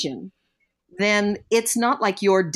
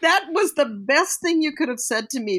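tearful; 7 semitones higher than usual. Real, not acted. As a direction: voice catching with tears while laughing. Moved telling grandmother's advice.

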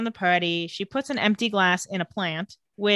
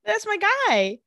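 high voiced quote; 11 semitones higher, slower than usual. Voicing a character's cheerful reaction.

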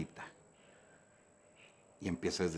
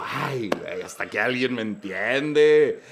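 slow, louder overjoyed relief; relieved gratitude; 5 dB louder, slower than usual. Retold or imitated. Speaker thanking the psychologist for agreeing with him, slow relief. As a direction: savour finally being understood.

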